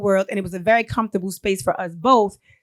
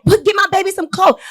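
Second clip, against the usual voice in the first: mother's demand; 8 semitones higher than usual. Retold or imitated. Mother yelling at jail staff for her son's clothes, high demand. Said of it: "she's demanded".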